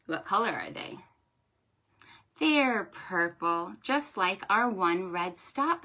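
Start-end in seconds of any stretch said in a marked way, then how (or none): none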